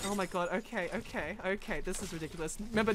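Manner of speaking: ordinary talk, nothing marked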